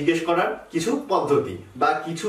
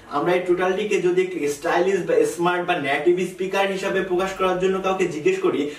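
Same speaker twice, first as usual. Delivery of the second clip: ordinary delivery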